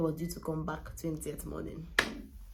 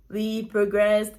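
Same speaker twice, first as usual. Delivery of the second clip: slow and loud, drawn out; emphatic; 4 semitones higher, 11 dB louder, much slower than usual. Real, not acted. Mother proudly telling her pregnancy progress, slow and loud. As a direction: stretch it out proudly.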